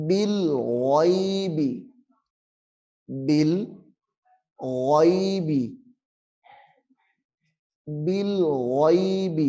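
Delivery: بالغیب with a thick, full-mouthed غ; heavy غ. Shown as a demonstration. Teacher shows the heavy letter. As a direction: round out the غ in بالغیب heavily. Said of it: "موٹا کرنا ہے".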